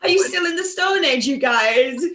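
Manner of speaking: high, laughing, mocking astonishment; laughing disbelief. Teasing her American friend, laughing and high. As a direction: laugh at the Stone Age idea in amazement. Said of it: "I go".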